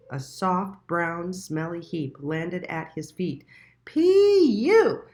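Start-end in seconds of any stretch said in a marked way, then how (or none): 3.8-5.0 high, loud disgusted cry; recoiling from the stink; disgusted yuck. A narrator's reading. Little Mole smelling the heap, loud and disgusted.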